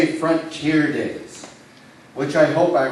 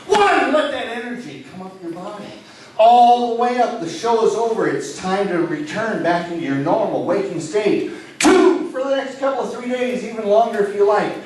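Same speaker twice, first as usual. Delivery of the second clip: higher, louder energizing voice; rousing wake-up patter; hypnotist count-up; 8 semitones higher, 4 dB louder than usual. Acted. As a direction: lift them out of trance with energy.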